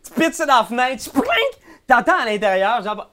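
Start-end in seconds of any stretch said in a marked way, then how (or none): 1.0-1.3 high shouted poupling crash; sound effect. Acted. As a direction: shout the smash noise. (bruitage aigu)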